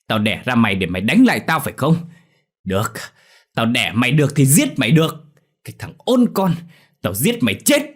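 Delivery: loud, high-pitched threatening rant; scornful anger swelling into threats; furious father. A narrator's reading. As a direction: rage at your son, building to a yell. Furious father refuses mother's plea.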